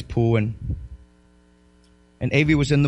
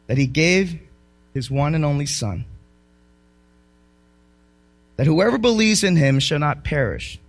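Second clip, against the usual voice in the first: scripture reading; 4 semitones lower than usual. Acted. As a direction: let the verse land gently.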